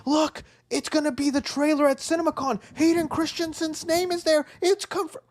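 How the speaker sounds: high-pitched mock fanboy hype; excited fans, mocked. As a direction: imitate gushing fans in a high voice.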